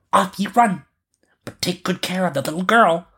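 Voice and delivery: put-on high child's voice sending it off; robber girl commanding. A narrator's reading. Robber girl sends the reindeer running, commanding. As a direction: send it off in a high little voice. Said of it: "and said to the reindeer".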